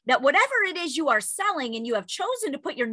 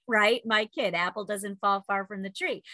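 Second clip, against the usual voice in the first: ordinary talk, nothing marked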